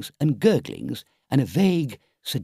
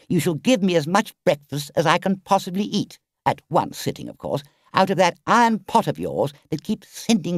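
firm Toad; 7 semitones higher than usual. Acted. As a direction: lay down terms like a grand gentleman. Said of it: "At last he said firmly".

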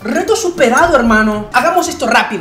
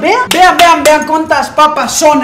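carried away with excitement; 5 semitones higher, 4 dB louder than usual. Real, not acted. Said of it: "Perdón, me emocioné".

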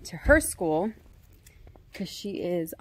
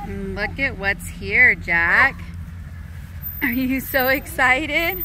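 slow, higher, louder cheerful singsong; excited; 6 semitones higher, 4 dB louder, slower than usual. Real, not acted. Mom hyping her daughter up for the school bus, excited.

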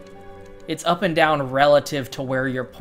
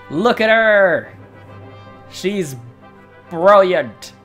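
awestruck; 3 semitones higher, 4 dB louder, slower than usual. Real, not acted. Amazed at the queen.

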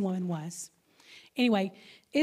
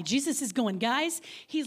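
ordinary delivery

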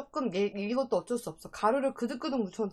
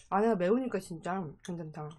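talking while chewing; words muffled by food; mouth full of chicken; 4 semitones lower than usual. Real, not acted. Seen 1: Bites fried drumstick mid-speech, then chews with lips closed.

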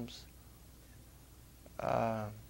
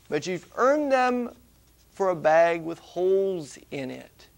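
scripture reading; 6 dB louder than usual. Acted. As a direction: proclaim each phrase with weight. Reads scripture passage aloud.

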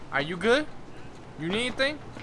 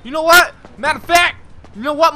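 angry; 2 semitones higher, 7 dB louder than usual. Real, not acted. Squaring up to a rival.

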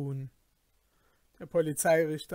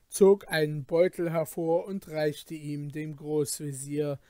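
usual speaking voice

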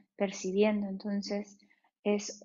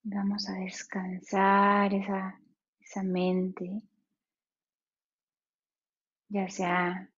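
guided meditation. Acted. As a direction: ease them into stillness, unhurried.

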